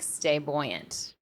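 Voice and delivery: ordinary talk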